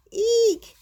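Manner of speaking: high, loud "eek"; cartoon squeal. A narrator's reading. Kitty hearing of cold water, alarmed squeal.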